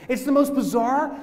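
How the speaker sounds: ordinary delivery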